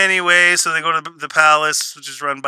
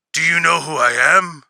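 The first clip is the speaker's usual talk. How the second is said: gruff, growled Batman-style demand; Batman Begins style. Retold or imitated. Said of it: "All Batman Begins style".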